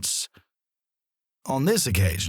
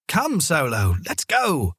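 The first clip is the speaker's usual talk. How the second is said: impatient call; 5 semitones higher than usual. A narrator's reading. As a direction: hurry the dog along, losing patience. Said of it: "more impatiently this time".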